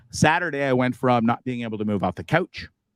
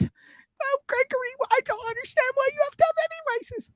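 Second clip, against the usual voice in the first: shrill falsetto mom, much higher. Acted. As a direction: squeal it out like a fretting mother. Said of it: "Well, Mom".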